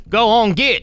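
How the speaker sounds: put-on country accent. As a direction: shoo them off in a country accent. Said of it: "I don't know why I'm doing that accent".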